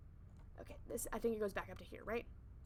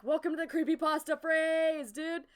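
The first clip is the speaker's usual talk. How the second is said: hyped welcome; 4 semitones higher, 9 dB louder, slower than usual. Real, not acted. Welcoming stream to creepypasta.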